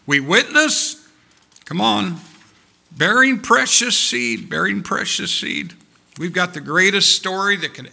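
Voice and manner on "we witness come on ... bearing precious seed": preaching build. Acted.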